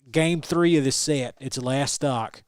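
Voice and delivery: ordinary talk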